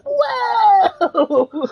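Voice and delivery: long high-pitched whoa, laughing; exaggerated wail of wonder; mock amazement. Cohost mocking a two-game phone, mock amazement.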